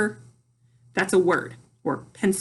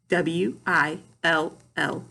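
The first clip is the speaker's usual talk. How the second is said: slow, letter by letter; spelled out; 3 semitones lower, slower than usual. Shown as a demonstration. Teacher spelling "will" for pupils, slowly spelled out. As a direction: spell W, I, L, L with a gap after each. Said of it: "we spelled it and blended it".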